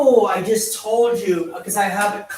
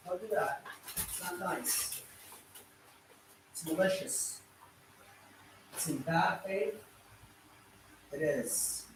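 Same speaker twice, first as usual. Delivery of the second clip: quiet scolding; 15 dB quieter than usual. Real, not acted. Scolding interrupter under his breath.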